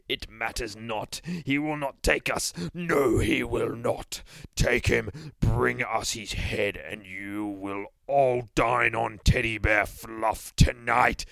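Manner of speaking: slow, loud, grand villain voice; villainous overlord. Acted. Boogeyman orders minions to bring his head, slow villainous command. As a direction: draw it out like an evil ruler.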